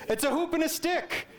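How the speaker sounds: put-on high, eager little-kid reply; a child sure he's right, answering high. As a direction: answer proudly like a six-year-old. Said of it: "and what was the answer?".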